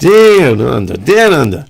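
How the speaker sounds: swooping mock Australian accent. Co-host joking about guys from Australia, mock Aussie accent. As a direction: put on an exaggerated Aussie twang.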